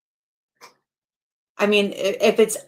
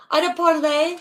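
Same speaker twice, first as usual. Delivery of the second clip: a playful, raised and questioning call for a parley; high mock lawyer; 9 semitones higher than usual. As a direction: pitch up high and end on a rising question. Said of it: "I'd say".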